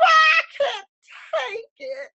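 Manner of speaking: a high crying outburst that collapses to nothing; breaking down. Retold or imitated. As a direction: cry it out, overwhelmed. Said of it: "I just lost it one day".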